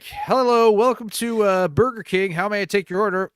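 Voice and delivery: high, loud drive-thru greeting; bright mock Burger King cashier; cheery order-taker. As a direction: chirp the greeting at the customer. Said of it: "you're gonna get a lot of this".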